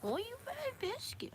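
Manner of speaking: squeaky-high pet talk. Real, not acted. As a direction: scold the pet playfully, sky-high.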